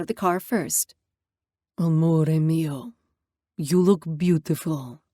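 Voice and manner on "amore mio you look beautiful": put-on lower, slow, grim Italian accent; thick Italian accent. Italian father greeting his daughter, grim thick Italian accent. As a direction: purr it with a heavy Italian accent. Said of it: "A thick, grim Italian accent".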